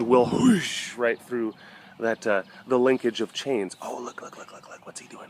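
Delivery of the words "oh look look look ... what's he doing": soft, hushed words while watching a cat. Real, not acted. Spotting a cat doing something.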